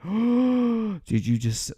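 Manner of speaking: high, slow stunned disbelief; shocked gasp. Retold or imitated. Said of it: "this face of shock".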